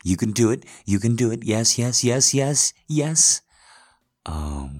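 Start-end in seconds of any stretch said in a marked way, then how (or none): 0.0-3.4 excited cheering. Acted. Cheering partner on, loud.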